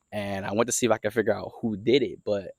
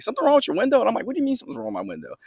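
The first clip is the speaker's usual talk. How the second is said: high-pitched, puzzled phone exchange; incredulous, pitch jumping up; retold disbelief; faster than usual. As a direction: act out being baffled by the news. Retelling startled phone exchange with dad.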